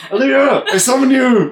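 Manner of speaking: muffled, far-off chant; distant mumbling summon. Acted. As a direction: murmur the summon as if from afar. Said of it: "mumbling from".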